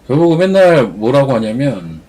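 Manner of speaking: normal talking voice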